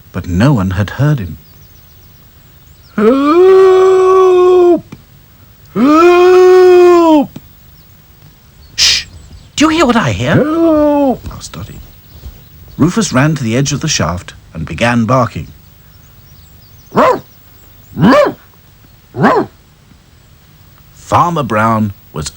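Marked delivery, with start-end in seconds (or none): 3.0-7.4 high, long loud shouts; desperate cry. Acted. Trapped farmer shouting for help, desperate. 8.8-10.3 high, hushing listen voice; alert Dotty. Acted. Dotty hushing dolls, alert. 10.3-11.3 high cry; distant cry for help. Acted. Farmer calling from shaft, distant cry. 16.9-19.5 high, loud ruffs; dog barking. Acted. Dog barking at shaft edge, loud barks.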